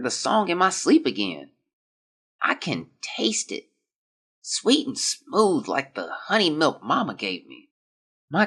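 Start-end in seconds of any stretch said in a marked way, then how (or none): 2.4-5.9 whispering. Acted. Dying boy's eerie journal line.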